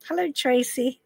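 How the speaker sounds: normal talking voice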